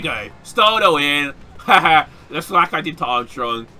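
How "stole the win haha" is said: loud, crowing victory call; gloating triumph. Real, not acted. Gamer celebrating a stolen win, gloating triumph. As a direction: crow it loudly, then laugh.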